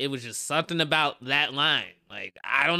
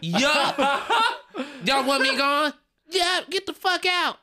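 high, louder crowd answering; hammed-up crowd telling him to leave; mock crowd yell; 11 semitones higher, 3 dB louder, slower than usual. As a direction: crowd yelling back at the comic, pitched high.

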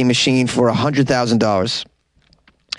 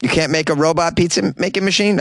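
normal talking voice

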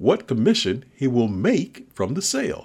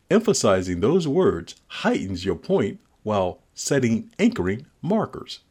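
normal talking voice

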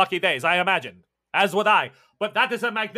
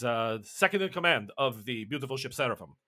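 ordinary delivery

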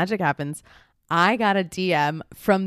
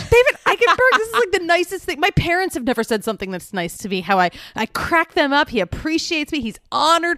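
hoog en overweldigd, vol ongeloof; opgewonden; 9 semitones higher than usual. Real, not acted. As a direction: sta te stuiteren van blijdschap. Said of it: "I was blown away by this message".

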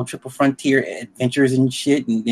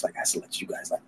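whispered aside; 14 dB quieter than usual. Real, not acted. Repeats line under breath.

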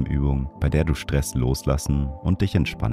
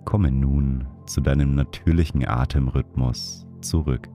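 guided meditation; 3 dB quieter than usual. Acted. Leads a breathing meditation.

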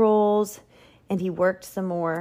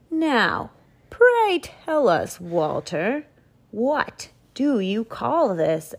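lordly Duke; 4 semitones higher, slower than usual. Acted. Duke asks in his voice.

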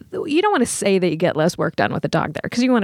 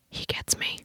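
soft breathy aside; whispered; 13 dB quieter than usual. Retold or imitated. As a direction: whisper it like a secret. Inner thought about dog.

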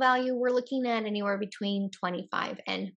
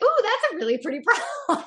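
delighted; 11 semitones higher, 5 dB louder than usual. Retold or imitated. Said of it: "And I just remember being like".

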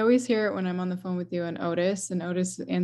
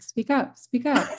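higher-pitched imitation of listeners; mock urging; 6 semitones higher than usual. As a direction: lightly mimic someone asking for volume. Imitating people who can't hear her.

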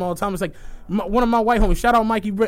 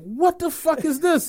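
mock outrage; 8 semitones higher, slower than usual. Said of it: "I'm like".

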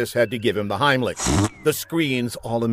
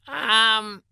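high, drawn-out nervous hesitation; hesitant scientist; 10 semitones higher than usual. A narrator's reading. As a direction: stall before breaking bad news to the boss.

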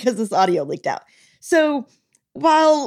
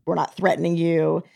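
low, calm, measured reassurance; calm general; 6 semitones lower, 3 dB quieter than usual. Retold or imitated. General calmly telling the prince he's not being threatened. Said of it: "really calmly just kind of".

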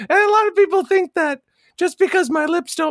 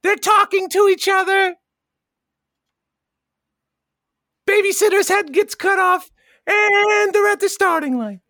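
race announcer; 3 semitones higher than usual. Pretend. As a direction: stretch the first word like a track announcer. Calls an imaginary car race.

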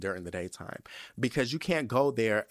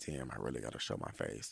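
much lower and softer, dreading it; quiet dread; low embarrassed mutter; 13 semitones lower, 12 dB quieter than usual. Retold or imitated. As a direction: grumble it to yourself. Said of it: "But I'm like".

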